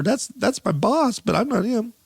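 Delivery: sneak in the punchline like a sly admission; lightly hammed caricature. Mocking quote about Satan as boss.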